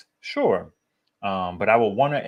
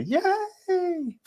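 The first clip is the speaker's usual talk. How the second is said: "yay" stretched out way up high; high kiddie cheer. Shown as a demonstration. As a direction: strain up high for a little kid's yay. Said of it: "My voice don't even go that high".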